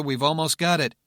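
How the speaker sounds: ordinary delivery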